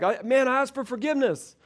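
mock a defensive spouse demanding trust; whiny offender. Offending spouse complains about not being trusted, whining. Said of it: "they're like".

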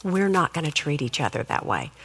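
tone down. Shown as a demonstration. Mother showing parents how to correct kids, softly. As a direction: bring the voice right down so they lean in. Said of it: "say something like this".

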